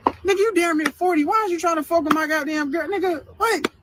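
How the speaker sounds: high, incredulous outburst at the uncle; outraged disbelief. Real, not acted. Reading uncle's texts, outraged and pitched high. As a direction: voice jumps up in shocked outrage.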